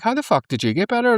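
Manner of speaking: high, incredulous question; mock disbelief. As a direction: voice exaggerated astonishment. Scoobies' astonished question, retold.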